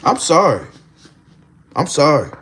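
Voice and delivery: ordinary talk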